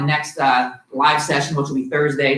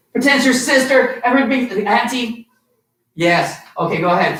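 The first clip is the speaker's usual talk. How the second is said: ordinary talk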